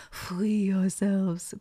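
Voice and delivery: slow, drawn-out mock French accent; terrible French accent. Borrowing a film lady's line, bad French accent. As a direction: stretch FREE in a hammed-up French accent. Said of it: "Please pardon my terrible French accent".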